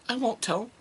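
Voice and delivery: high, soft, small voice; high soft meek I won't tell; imitating scared daughter. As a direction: squeak it meekly.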